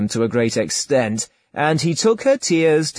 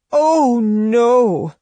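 high, loud protest of mock dismay; feigned cry; 11 semitones higher, 5 dB louder than usual. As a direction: cry out as if wounded by his offer. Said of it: "she cried".

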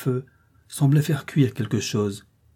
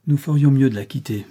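ordinary delivery

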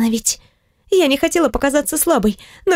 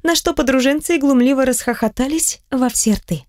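ordinary delivery

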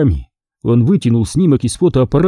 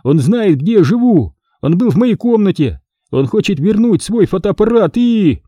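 raised-pitch, urgent outpouring; crying out; 7 semitones higher than usual. A narrator's reading. Greg explains Spider knows his home, crying out urgently. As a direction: pile up the alarms, voice pitched up. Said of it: "вскричал Грег".

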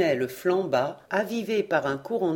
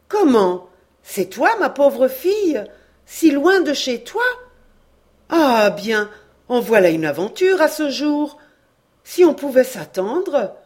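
higher, louder, astonished and worried; the old aunt recognizing the girl, crying out higher and louder; moved cry; 6 semitones higher, 9 dB louder than usual. A narrator's reading. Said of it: "s'écria très émue, presque inquiète".

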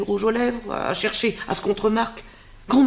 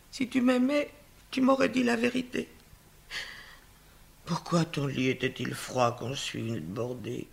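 softer, lower lament; hurt, tearful; 3 semitones lower, 6 dB quieter than usual. Acted. Nurse laments to Antigone, softer and lower, hurt. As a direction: grieve her broken trust.

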